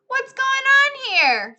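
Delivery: high, loud and slow call. A narrator's reading. Stanley calls from beneath the board, high and loud. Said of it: "he called out".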